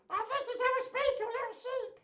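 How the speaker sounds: very high, squeaky puppet voice; high-pitched toy sheep squeal; Little Sheep. Pretend. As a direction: pipe up as a tiny excitable sheep. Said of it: "in Little Sheep's voice".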